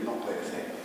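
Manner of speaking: hushed, breathless "No puede ser"; a stunned young man barely voicing it; disbelieving whisper.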